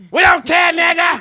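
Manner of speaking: loud, high laughing yell; mock bigot shout. Mocking Sue's Boston family, high shout.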